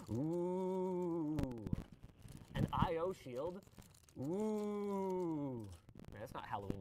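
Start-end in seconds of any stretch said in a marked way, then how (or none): 0.1-5.7 slow haunting ghostly moan; spooky voice. Acted. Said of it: "Why am I doing spooky voice?".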